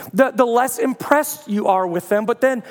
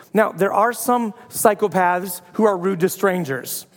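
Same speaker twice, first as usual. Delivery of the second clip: ordinary talk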